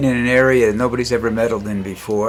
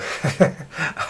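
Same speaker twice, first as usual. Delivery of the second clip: laugh; 6 semitones higher than usual. Real, not acted. Chuckle at own joke.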